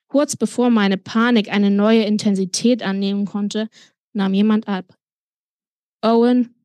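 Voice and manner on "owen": lightly voiced gruff deputy; a hint of a rumbling phone answer; brief, lightly rumbled greeting; deep rumbling voice. A narrator's reading. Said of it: "tiefe, brummende Stimme von Alex' Stellvertreter".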